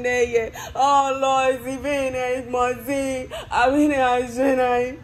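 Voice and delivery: loud, high ecstatic tongues with a crying edge; speaking in tongues. Real, not acted. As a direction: pour it out in tongues, half sobbing. Praying in tongues, overcome.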